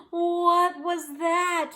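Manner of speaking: high, slow, loud startled question; gasp, then draw it out high and loud; frightened gasp. A narrator's reading. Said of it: "dropped his mug in fright".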